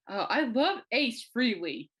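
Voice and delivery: mock-enthusiastic, savoring every word; gushing doctor. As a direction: imitate a doctor swooning over a band. Doctor gushes about the band.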